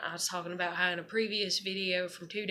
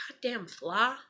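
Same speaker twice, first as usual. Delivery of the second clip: slow exasperated whisper under a sigh; annoyed whisper; slower than usual. Real, not acted. Woman batting away a fly, annoyed whisper. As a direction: hiss it at the fly, fed up.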